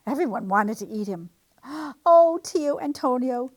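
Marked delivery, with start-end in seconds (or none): 1.6-3.5 gasped. A narrator's reading. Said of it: "he gasped".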